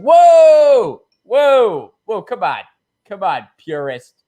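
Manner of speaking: high loud scoffing whoa; mock alarmed whoa. Crowd scolding a purist, high loud mocking whoa. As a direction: shout whoa like a scandalized centrist. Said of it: "It's just like".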